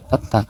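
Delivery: থাত্তা with an aspirated th at the start; th pronunciation. Shown as a demonstration. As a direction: begin থাত্তা on a breathy th, not d. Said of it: "থাত্তা মানে এভাবেও উচ্চারণ". (থাত্তা-র শুরুতে থ উচ্চারণ)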